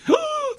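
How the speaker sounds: high, hammed-up gasp of wonder; mock gasp. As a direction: gasp like a dazzled fan. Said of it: "everybody started saying".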